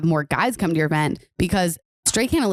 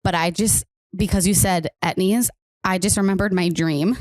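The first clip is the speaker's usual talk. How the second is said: ordinary talk, nothing marked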